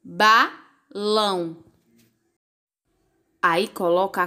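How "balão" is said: "Balão" split slowly into Ba, lão; "Balão" with a clear gap after Ba; syllable by syllable. Shown as a demonstration. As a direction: sound out "Balão" in two separate beats. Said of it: "Quantas sílabas?".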